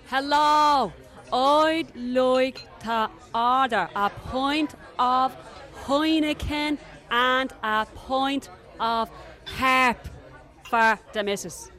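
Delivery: over-pronounce it loud and slow for a foreigner. Retold or imitated. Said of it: "speak really loud and really slow".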